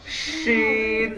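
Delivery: শিন with a heavy "sh" and long vowel; thick shin. Shown as a demonstration. Teacher drilling the letter, thick drawn-out শিন. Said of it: "এটা মোটা".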